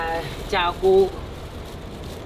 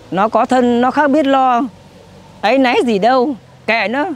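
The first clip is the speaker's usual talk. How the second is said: loud dismissive retort; 5 semitones higher, 5 dB louder than usual. Acted. Mother dismisses child loudly.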